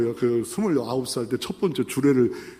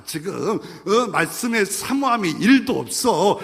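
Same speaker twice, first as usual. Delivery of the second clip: ordinary delivery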